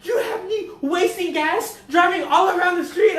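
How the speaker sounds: high, loud, drawn-out mock scolding; exasperated Mexican mom. Mom scolds son for wasted gas, exasperated wail. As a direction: wail it like an outraged Mexican mother. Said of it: "I gave her a Mexican accent".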